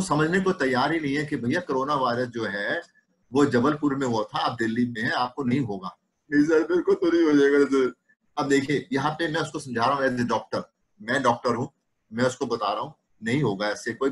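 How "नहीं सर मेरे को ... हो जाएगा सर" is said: anxious patient. Retold or imitated. Doctor voices anxious patient.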